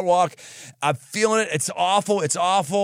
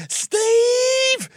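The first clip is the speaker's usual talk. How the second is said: mom yelling name. Retold or imitated. Mom calling her son up, high shout.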